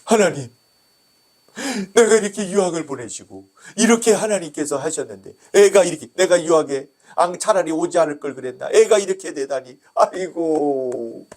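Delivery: high, tearful complaint to God; acted grieving plea; anguished lament. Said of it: "너무 답답하고 힘들었던 거예요".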